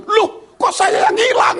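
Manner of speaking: high, loud, slow shout of disbelief; indignant disbelief. Retold or imitated. Pastor shouting back at the man who blamed him. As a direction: throw the blame back, outraged.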